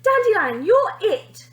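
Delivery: loud, high playground call; child yelling you're it in tag. A narrator's reading. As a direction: shout it across the playground. Kids calling in playground.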